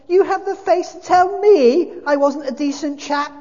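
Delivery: loud, high-pitched outburst; outraged gasp. A narrator's reading. Ghost told he wasn't decent, gasping outrage. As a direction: explode with affronted disbelief. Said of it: "gasps the ghost".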